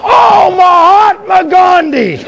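high, slow mock curse; pained yell. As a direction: yell it like a drawn-out oath. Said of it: "hammer your foot and yell".

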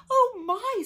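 high, loud gasp of surprise; startled exclamation. A narrator's reading. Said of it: "said Harry".

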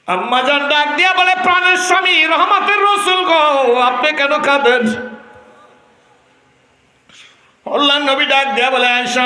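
high, loud chanting storytelling; full-force preaching. Acted. Preacher voicing Aisha answering her husband, loud high cry.

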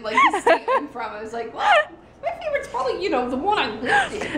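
high, squealing through laughter; real laughing. Real, not acted. Friend explaining a mishearing of Oregon, laughing hard. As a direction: talk while cracking up.

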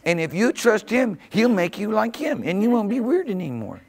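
high, loud, impassioned preaching; full-force preaching. Real, not acted. Preacher urging the congregation to trust God, full-force preaching. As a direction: drive it home with soaring pitch and volume.

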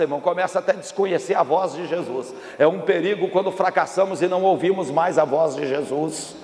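ordinary talk, nothing marked

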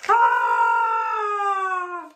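high startled scream; falling troll cry; troll yelp. A narrator's reading. Troll knocked off bridge.

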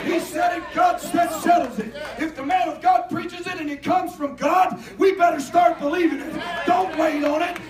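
loud, rising preacher's cry; preaching at full force. Acted. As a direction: preach it hard to the congregation.

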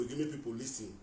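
ordinary talk

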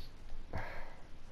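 drawn-out unvoiced sigh of disbelief; long sigh. Real, not acted. Fan still in shock at the result, sighing. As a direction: breathe it all out. Overwhelmed after the win.

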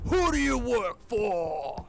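loud, drawn-out demand; hammed-up slow shout; mock interrogation. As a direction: bellow it slowly like a hammy interrogator.

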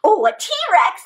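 ordinary delivery

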